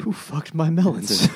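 high-pitched indignant neighbour; mock outrage. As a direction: demand it like an outraged melon owner. Acts out outraged melon owner.

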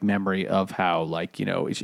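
normal talking voice

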